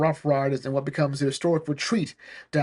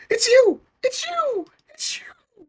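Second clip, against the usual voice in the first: very high gasps fading to a whisper; hammed-up dramatic reveal; shocked recognition; 3 dB louder, slower than usual. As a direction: gasp it out, dying away. Two men recognising each other.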